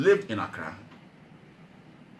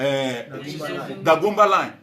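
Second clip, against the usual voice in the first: ordinary delivery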